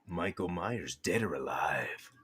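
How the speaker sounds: breathy hushed whisper. Pretend. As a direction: whisper it like a threat. Ominous quote whispered to co-host.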